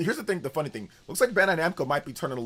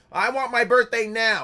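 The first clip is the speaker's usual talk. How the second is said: higher, louder, slow bratty demand; whiny kid tantrum; 8 semitones higher, 8 dB louder than usual. Retold or imitated. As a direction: wail it like a furious little kid. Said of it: "I used to always get pissed off at my mom".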